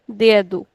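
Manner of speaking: dedo with DE stretched and do short; stretched first syllable. Shown as a demonstration. Teacher models dedo for students, stretching the first syllable. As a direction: hold de in dedo, clip the ending. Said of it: "We are stretching this and shortening this".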